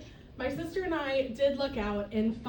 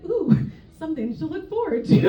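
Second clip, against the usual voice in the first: wry sad quip; 3 semitones higher, 7 dB louder than usual. Retold or imitated. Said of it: "a very sad statement".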